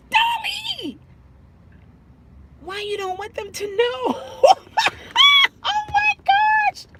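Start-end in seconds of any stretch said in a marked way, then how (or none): 0.1-1.0 high, shrieking name call; surprised shriek at a viewer; shocked cry. Real, not acted. 5.6-6.9 high, loud laughing shriek; laughing shriek at the news. Real, not acted.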